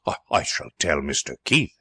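low, halting threat; choked with anger. A narrator's reading. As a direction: stammer out a warning through choked wrath. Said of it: "choked him".

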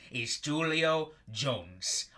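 is Julio Jones said very slowly like a class drill; slow repeat-after-me. Shown as a demonstration. Host drilling listeners like a class, very slow. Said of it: "Repeat after me".

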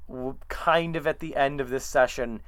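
ordinary delivery

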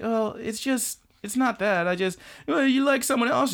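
put-on high, hesitant girl's voice; high girl turning down a suitor; gentle anime girl. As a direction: let him down, high and halting. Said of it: "And she's like".

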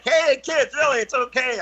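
high call to someone running off; calling after them. Retold or imitated. Storyteller calling after running kids, high reassuring shout.

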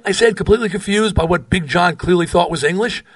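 ordinary delivery

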